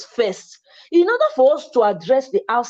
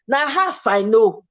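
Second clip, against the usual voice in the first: ordinary talk, nothing marked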